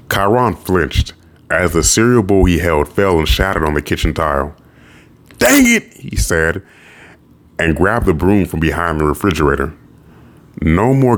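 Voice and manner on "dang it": startled exclamation. Acted. Bowl shattered, character exclaims.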